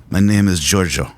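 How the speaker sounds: hammy imitation of an accented record producer; [name]. Podcast host testing an impression for the co-host, put-on accent. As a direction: try out a put-on foreign accent. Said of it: "should I do the [name] voice?".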